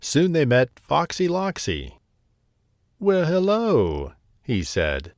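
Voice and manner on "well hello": sly fox. Acted. Sly fox greets the birds.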